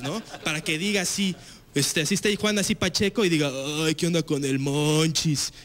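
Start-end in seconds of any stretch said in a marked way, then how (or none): 3.8-5.5 slow, drowsy stoned drawl; lightly voiced stoned guy; stoner voice. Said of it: "Juan Pacheco".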